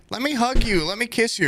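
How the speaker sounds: put-on high, sweet girlish voice; mock clingy girl. Mocking the movie's girl begging for hugs in a high girlish voice. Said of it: "the main girl ... time's like".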